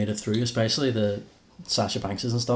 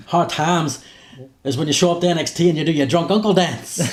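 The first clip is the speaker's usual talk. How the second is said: Dusty Rhodes; 5 semitones higher, 5 dB louder than usual. Retold or imitated. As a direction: ham up a loud old wrestling promo. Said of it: "Dusty Rhodes".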